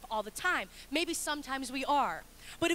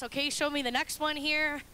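ordinary talk

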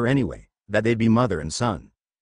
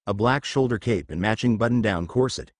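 plain reading voice

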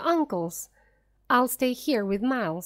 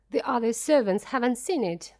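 plain reading voice

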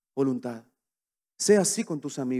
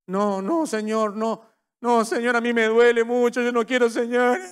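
higher, mild tearful pleading; pleading and crying; 6 semitones higher, 3 dB louder than usual. Retold or imitated. Believer begging the Lord to spare him, mock tearful. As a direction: beg the Lord not to be shaken, a touch of tears. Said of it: "Y comenzamos a llorar".

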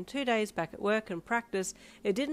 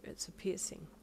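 soft, sleepy murmur; barely awake, answer softly; groggy; 8 dB quieter than usual. A narrator's reading. Mother woken from a nap answers child, groggy. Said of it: "you said groggily".

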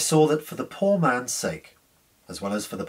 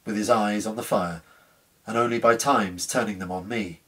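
ordinary delivery, nothing marked

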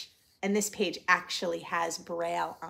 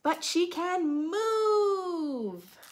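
slow drawn-out line; 8 semitones higher, 4 dB louder, much slower than usual. A narrator's reading. Storybook line stretched out.

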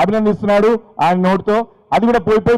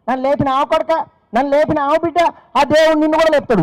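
put-on old woman's blessing; 9 semitones higher than usual. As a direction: bless him like a grateful granny, rising to the end. (పైకి పోయిన స్వరంలో ముసలమ్మ దీవెన)

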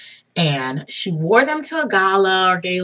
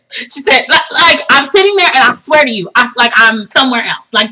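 usual speaking voice

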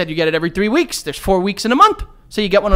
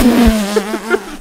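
bursts out laughing; a loud laugh; 3 semitones higher, 6 dB louder than usual. Real, not acted. Laughing at own boast.

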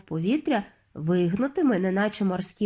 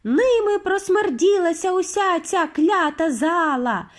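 high, wailing witch outcry; high-pitched, stretched yelling; screaming; 8 semitones higher, slower than usual. A narrator's reading. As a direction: wail in frenzied disgust. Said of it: "репетували вони".

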